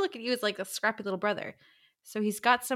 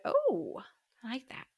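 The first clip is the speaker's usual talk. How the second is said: intrigued whisper; 3 semitones higher, 5 dB quieter than usual. Retold or imitated. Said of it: "I was like".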